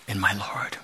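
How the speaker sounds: hushed whisper of Thomas's words; whispered quote. Retold or imitated. Preacher quoting Thomas, whispered in awe. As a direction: breathe it out in awe. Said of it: "like Thomas said".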